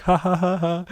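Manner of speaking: high forced ha-ha, then the movie line; fake laugh. Traveller humouring bouncers to get in, fake jollity. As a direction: humour them with a mock laugh and quote. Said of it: "And I'd be like".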